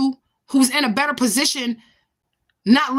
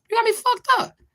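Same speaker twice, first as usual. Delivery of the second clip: defiant; 9 semitones higher than usual. Real, not acted. Defiant reply to viewer.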